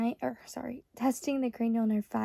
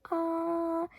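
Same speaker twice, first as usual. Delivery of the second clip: high held ahh; saying ahh; 6 semitones higher than usual. Shown as a demonstration. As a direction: say ahh like at the doctor's. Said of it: "have you say".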